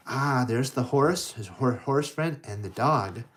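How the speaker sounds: usual speaking voice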